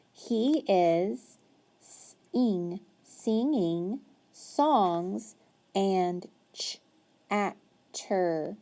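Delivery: very slow reading, each word drawn out; slow modeled reading. Shown as a demonstration. Teacher reading aloud to a child, very slow. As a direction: read slowly so the child follows. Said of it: "follow along as I read".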